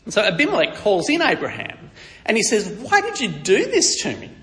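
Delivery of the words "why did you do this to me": high-pitched, aggrieved confrontation; indignant rebuke. Acted. Wronged king confronting Abraham, indignant. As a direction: demand an explanation, pitched well up.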